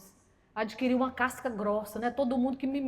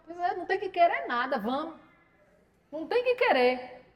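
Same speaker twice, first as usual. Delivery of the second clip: ordinary talk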